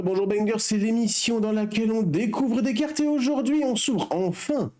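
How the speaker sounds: bright, high show-opening voice; energetic announcer welcome; show host intro. Acted. Channel intro catchphrase.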